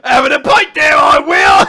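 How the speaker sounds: high, loud hammed-up British accent; mock posh British shout. Imagining riders calling dragons, hammed-up British accent shouting. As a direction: yell it like a silly posh Brit. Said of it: "to command your dragon".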